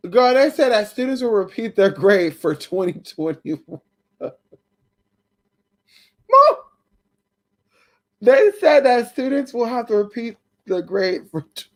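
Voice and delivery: words pushed through giggles; tired, giddy laughter as you read it out; real laughing; laughing while talking. Real, not acted. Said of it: "I'm really laughing 'cause I'm tired".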